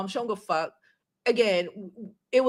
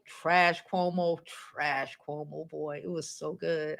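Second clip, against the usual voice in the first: savoring; 7 semitones lower, 8 dB quieter than usual. Real, not acted. Savoring the rally moment.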